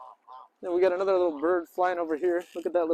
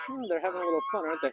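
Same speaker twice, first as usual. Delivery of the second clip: ordinary talk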